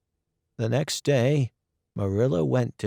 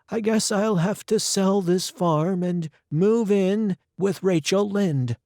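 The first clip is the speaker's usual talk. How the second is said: higher voiced Marilla, worried about money; older woman voice, higher than the narrator; Marilla's voice; 7 semitones higher, 3 dB louder than usual. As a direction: play the old guardian sadly admitting she's broke.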